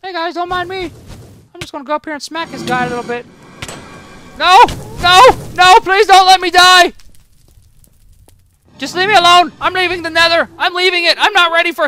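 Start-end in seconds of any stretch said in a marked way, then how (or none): 4.4-11.9 high, loud desperate begging; panicked pleading. Real, not acted. Gamer begging the Nether mobs not to kill him, panicked. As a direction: plead for your life.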